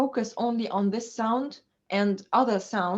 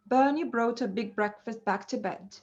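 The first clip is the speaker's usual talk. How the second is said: the whole sentence in one fluent breath; tongue twister, run together. Shown as a demonstration. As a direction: link the b words smoothly without pauses. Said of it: "like a tongue twister".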